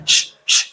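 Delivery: "श" as a long hissing consonant; hissing sibilant demo. Shown as a demonstration. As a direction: hold "श" as pure hiss to show the sound. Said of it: "आवाज देखो इसमें से". ("श" की लंबी सीटी जैसी ऊष्म ध्वनि)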